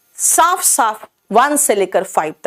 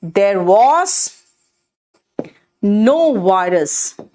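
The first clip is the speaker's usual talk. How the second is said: no virus drawn out, very slow; slow model phrase; 2 semitones lower, much slower than usual. Shown as a demonstration. Teacher to students, slowly spelling out the phrase. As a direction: stretch each word for students. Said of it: "teacher slow model sentence".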